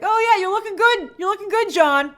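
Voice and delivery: high, loud sarcastic cheer in a put-on voice; mocking flattery. Bucky sarcastically cheering on John Walker, high, loud and mocking. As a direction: tease him with fake praise. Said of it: "And Bucky's like".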